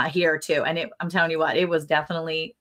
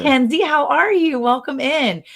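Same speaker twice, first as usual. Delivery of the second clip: cheerful greeting; 9 semitones higher, 6 dB louder than usual. Real, not acted. Greeting a viewer joining the stream.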